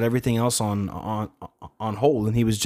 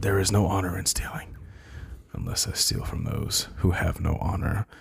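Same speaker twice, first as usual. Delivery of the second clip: whispered, soft and lower; hushed movie quote; 4 semitones lower, 5 dB quieter than usual. Retold or imitated. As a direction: breathe the line out as a hushed quote. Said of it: "He went".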